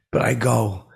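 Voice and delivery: ordinary talk, nothing marked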